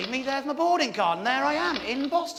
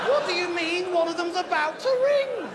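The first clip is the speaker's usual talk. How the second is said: panicked disbelief; 6 semitones higher than usual. Retold or imitated. Shocked guest to Warren, high panicked question. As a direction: fling the question out in alarm.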